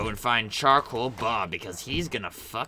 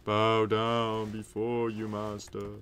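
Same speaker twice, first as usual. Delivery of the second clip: lightly voiced creature; 2 semitones lower, 5 dB quieter, slower than usual. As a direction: mumble obediently like a brute. Creature's reply.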